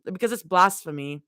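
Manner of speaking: low, steady read-aloud of a fanfic chapter; story narration. A narrator's reading. Streamer reading a fanfic chapter to chat, low and steady. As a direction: read it like a cozy audiobook.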